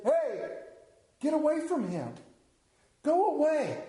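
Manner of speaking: mock scolding disciples. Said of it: "the disciples said".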